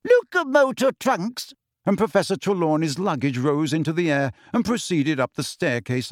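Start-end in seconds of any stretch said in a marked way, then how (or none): 0.0-1.5 high, squeaky little wizard casting; squeaky spell. A narrator's reading. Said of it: "He squeaked".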